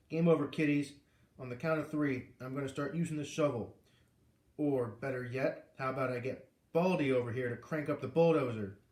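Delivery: ordinary delivery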